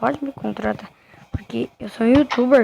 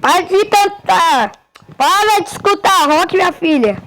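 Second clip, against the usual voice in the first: higher, louder nagging mother; scolding mom voice; 6 semitones higher, 3 dB louder than usual. Pretend. Mother telling her kid to stop singing rock, nagging.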